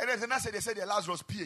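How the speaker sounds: normal talking voice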